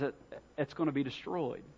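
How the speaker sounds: subdued sorrow. Retold or imitated. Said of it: "looked at it with emotion".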